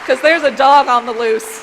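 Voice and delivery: big rousing shout to the crowd; rallying shout. Real, not acted. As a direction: pump up the room.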